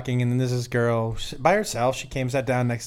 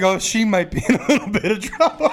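higher, breaking up into laughter; laughing punchline; 6 semitones higher than usual. Real, not acted. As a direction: try to finish while cracking up. Repeats punchline while cracking up.

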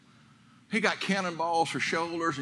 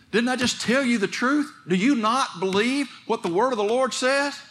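higher and louder, driving sermon voice; full-force preaching; 9 semitones higher, 5 dB louder than usual. Acted. Preacher urging his congregation, fiery and loud.

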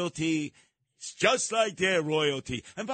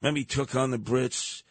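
usual speaking voice

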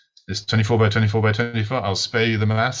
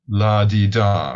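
slow, each syllable spaced out and louder; slow syllable demo; 5 dB louder, slower than usual. Shown as a demonstration. Explaining syllables to the listener, slow and deliberate. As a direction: sound out "La di da" one syllable at a time. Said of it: "you need one consonant and one vowel".

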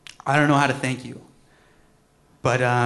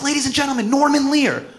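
higher, louder announcer intro; 10 semitones higher, 7 dB louder than usual. Retold or imitated. Introducing Norman Lear to a room of comedians, announcer call. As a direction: present him like a host on stage. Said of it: "I was like".

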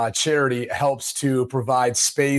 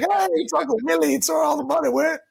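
high, hammed-up hillbilly Uncle Willie; 13 semitones higher than usual. Playing an uncle with moonshine to mock a shady charity. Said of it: "He's like".

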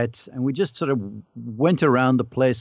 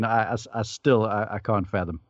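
usual speaking voice

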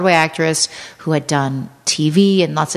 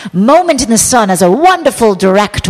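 sarcastically theatrical flourish; grandiose mockery; 4 semitones higher, 5 dB louder than usual. Real, not acted. Mocking the director's moment in the sun, grandiose. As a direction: swell the words with mock admiration.

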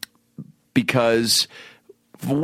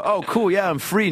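high, eager agreement; 8 semitones higher than usual. Retold or imitated. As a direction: sound instantly keen. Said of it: "you're never gonna go".

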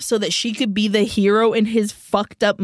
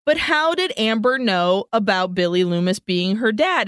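usual speaking voice

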